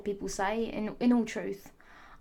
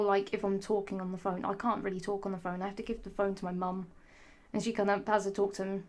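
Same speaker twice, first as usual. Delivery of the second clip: normal talking voice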